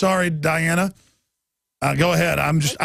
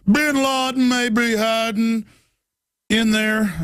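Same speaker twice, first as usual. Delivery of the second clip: higher, slow, ominous officer voice; mock TSA officer; 4 semitones higher, slower than usual. Host mocking TSA officers, high and slow. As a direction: warn it like a paranoid agent. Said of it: "Now it's like".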